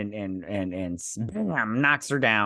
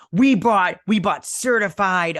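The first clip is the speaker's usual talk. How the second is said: high mocking voice; 7 semitones higher, 7 dB louder, slower than usual. Eunice's captor mocked in a high louder voice. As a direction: voice jumps up high and louder, a little slower. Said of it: "she's like".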